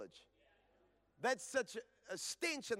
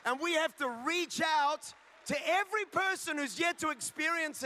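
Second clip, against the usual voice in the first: higher, louder preacher's call; rally the church from the pulpit; fired-up sermon delivery; full-force preaching; 6 semitones higher, 6 dB louder than usual. Acted.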